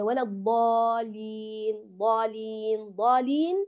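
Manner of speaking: ضالين with the doubled lam dropped to one light lam; mock error: ولا الضالين with only one lam; single lam error. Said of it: "يقول البعض هكذا".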